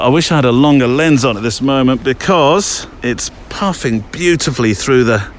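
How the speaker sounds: normal talking voice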